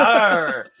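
mock pirate Arr; a light pirate snarl; pirate growl. Host repeats his pirate impression, gruff Arr. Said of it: "are you gonna do the voice".

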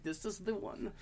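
childlike plea in mock German; little kid pleading in a hammed German accent. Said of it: "in my German accent".

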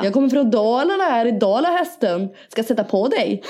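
lilting dalmål impression; Dalarna accent. Echoing co-host as a Dalarna local, Dalarna accent. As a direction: sing it out in Dalarna dialect. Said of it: "Dalmål".

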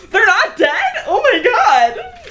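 high, loud, laughing disbelief; overjoyed disbelief. Acted. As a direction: gasp it out, thrilled and laughing. Fireheart hears the kits live.

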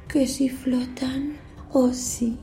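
slow, whispery creepy clown; giggly sinister sing-song. Acted. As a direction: tease him in a sly whisper. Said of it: "Soy Pennywise, el payaso".

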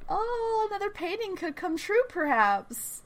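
high, softly lilting fake surprise; hint coyly in a high, airy singsong; playful high-pitched foreshadowing; mock wonder. Teasing dramatic hint about prophecy.

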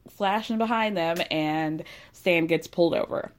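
ordinary talk, nothing marked

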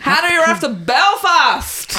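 badly faked Belfast accent, stretched slowly; attempted Belfast accent. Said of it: "Still can't do the accent".

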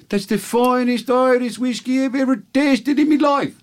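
very high, slow, crowing Irish brogue; over-the-top Irish amazement, drawn out; horrible Irish accent. Acted. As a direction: proclaim it in squealing stage-Irish. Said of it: "your horrible accent that makes the joke".

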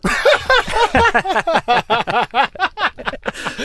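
long, high, loud real laugh; helpless laughter. Real, not acted. Guest cracks up at his own reveal, high helpless laughter. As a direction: burst into a long high cackle.

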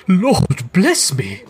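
slow, high cry of amazement; breath taken away. A narrator's reading. Gentleman hearing Scrooge's whispered gift, astonished. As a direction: draw it out, astounded. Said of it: "as if his breath were taken away".

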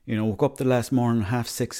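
normal talking voice

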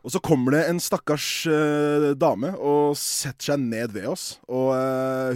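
normal talking voice

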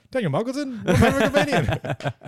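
high, loud mock astonishment; laughing, high-pitched imitation of disbelief; mock incredulity. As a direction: squeal it like a baffled fan. Imagined piss guy recognising comedian.

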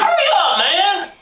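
high impatient yell; mock bossy shout. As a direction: yell at him to hurry. Director yelling at driver.

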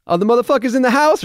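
loud, high whooping announcement, imitated; boisterous entrance. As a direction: burst in with a mock exuberant holler. Imitates star's voice heard off.

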